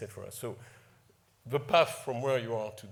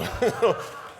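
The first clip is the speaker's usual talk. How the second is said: loud breathy laugh through emotion; sudden relieved burst of laughter; embarrassed laugh; 11 semitones higher, 10 dB louder than usual. Real, not acted. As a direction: laugh away the tears.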